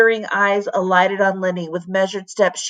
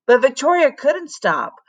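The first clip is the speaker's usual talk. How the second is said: plain reading voice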